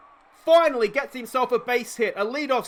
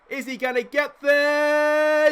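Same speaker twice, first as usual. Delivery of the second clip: higher, louder and slowly drawn out; tense excitement; 6 semitones higher, 5 dB louder, much slower than usual. Real, not acted. Fly ball, will he catch it.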